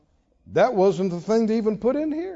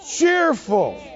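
normal talking voice

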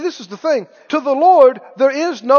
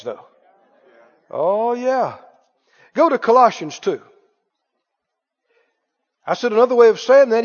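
ordinary talk, nothing marked

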